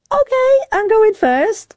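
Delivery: high, slow singsong okay; breezy acceptance. Retold or imitated. Swimmer agreeing to go first, breezy acceptance. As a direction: go along with it in a high, drawn-out voice. Acts her own compliant reply.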